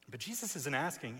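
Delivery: ordinary talk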